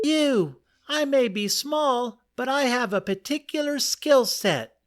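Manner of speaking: small but proud squeak; mouse. Acted. Mouse insisting to the lion she has skills, high squeak.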